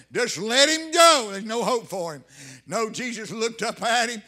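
loud, rising revival preaching; full-force preaching. Real, not acted. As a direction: preach it hard, punching up the key phrases.

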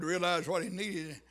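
normal talking voice

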